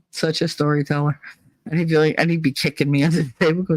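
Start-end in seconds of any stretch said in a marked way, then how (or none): none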